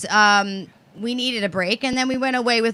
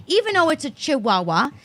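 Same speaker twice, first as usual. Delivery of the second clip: ordinary talk, nothing marked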